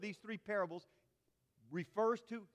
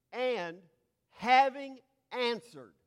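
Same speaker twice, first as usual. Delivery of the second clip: having answered read slowly and loud, pitched higher; solemn scripture quote, slow and loud; weighty reading; 5 semitones higher, 8 dB louder, slower than usual. Shown as a demonstration. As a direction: lean hard on having in having answered. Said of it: "I'm gonna try to translate this word".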